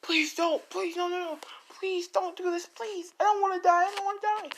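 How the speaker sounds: high, desperate begging scream; terrified pleading. Acted. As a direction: beg for your life in a shrill panic. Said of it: "Lady One screaming".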